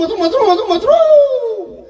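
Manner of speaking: hammed-up parody, stretch the final mushroom; mocking the rival. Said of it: "प्रत्येक वेळी mushroom". (Mushroom mushroom अशी चिडवणारी नक्कल)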